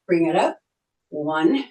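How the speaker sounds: ordinary talk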